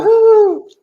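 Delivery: high loud celebratory whoop; excited whoop. Real, not acted. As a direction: whoop with joy. Celebrating the sub count.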